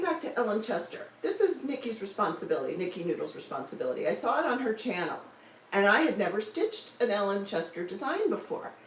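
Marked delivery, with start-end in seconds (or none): none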